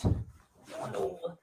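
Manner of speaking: slow whisper under the breath; whispered aside. Real, not acted. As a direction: murmur it to yourself while shifting. Moving seats, mumbling aside.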